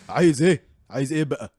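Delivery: loud hammed-up Arabic yelling; mock yelling. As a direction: shout it in mock anger. Mock Egyptian argument.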